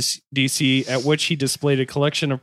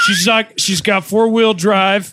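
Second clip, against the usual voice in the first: car salesman voice; 6 semitones higher, 6 dB louder than usual. Pretend. Mock salesman pitching wife.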